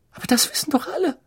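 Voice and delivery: very high, quick Hermione voice; loud stage whisper. A narrator's reading. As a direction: pipe up fast so everyone hears. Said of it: "flüsterte Hermine so laut, dass jeder es hörte". (hohes, schnelles, hörbares Einwerfen)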